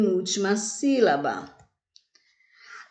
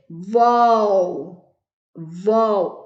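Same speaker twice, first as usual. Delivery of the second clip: "vol" punched out long, on its own; stressed syllable VOL; 4 dB louder than usual. Shown as a demonstration. Teacher isolating the strong syllable, a long firm "vol". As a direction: hit "vol" firmly and hold it. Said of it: "A sílaba mais forte".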